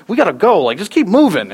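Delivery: mock bossy wife; driven wife. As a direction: push him to hurry. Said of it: "she's going".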